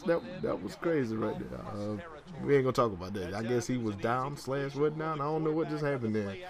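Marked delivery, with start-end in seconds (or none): none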